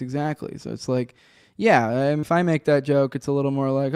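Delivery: ordinary delivery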